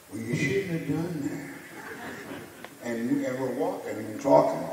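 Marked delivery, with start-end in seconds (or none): 0.1-2.9 higher, very slow laughing Ali voice; a slow, amused Ali, pitched up; Ali impression. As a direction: draw it out slowly like Muhammad Ali, amused.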